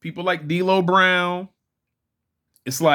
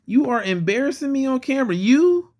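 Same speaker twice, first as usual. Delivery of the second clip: higher, emphatic "You are embarrassing me"; mock scolding; 9 semitones higher than usual. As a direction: playfully tell off a misbehaving toy, spelling out "You are". Repeats mock scolding, more emphatically.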